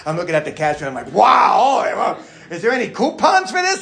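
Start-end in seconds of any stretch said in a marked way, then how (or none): 1.1-3.8 high, loud comic alarm; shocked outburst. Acted. Dad at the till seeing the total, mock shock. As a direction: yelp in mock panic at the bill.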